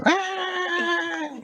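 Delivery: a high, squealing cartoon scream. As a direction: scream while running off. Worker runs away screaming.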